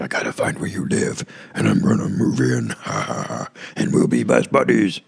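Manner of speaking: low, rasping stalker with a dark laugh, rising at the end; creepy stalker voice. Acted. Stalker planning to move in with the star, creepy put-on voice. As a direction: play a hammed-up creepy stalker. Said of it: "where did that voice come from".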